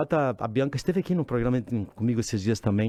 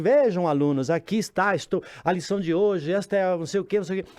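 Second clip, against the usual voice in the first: high, raised teacher voice explaining to the class; mock teacher; 6 semitones higher, 3 dB louder than usual. Father imitating a teacher lecturing the class, high and raised. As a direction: play a teacher at the board, lightly hammed.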